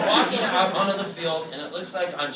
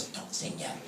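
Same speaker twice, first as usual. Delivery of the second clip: whispered echo; hushed warning; whispering it after him; 16 dB quieter than usual. Retold or imitated. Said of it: "And she goes".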